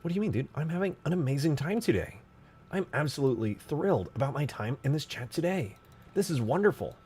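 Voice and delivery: high, sarcastic overjoyed voice; sarcastic cheer. Acted. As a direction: gush with fake delight.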